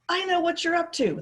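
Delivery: high menacing snarl. A narrator's reading. Bald foreman accusing Roy, snarling. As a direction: growl the accusation. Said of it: "the bald man snarled".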